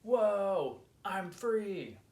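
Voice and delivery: high, loud cry of delight; thrilled whoop. A narrator's reading. Young Marty flying high for the first time, thrilled whoop. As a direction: whoop with the joy of first flight.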